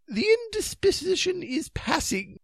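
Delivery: high, bravely formal voice; Sir Palomides. A narrator's reading. Knight recovering from his faint, high and brave. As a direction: put up a brave front in a high voice. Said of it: "said Sir Palomides bravely".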